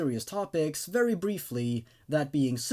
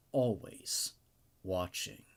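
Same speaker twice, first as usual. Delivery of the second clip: softer, lower, drawn-out title drop; ominous hush; 9 semitones lower, 7 dB quieter, much slower than usual. A narrator's reading. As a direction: let the last word sink to a creepy hush. Ominous title line in story.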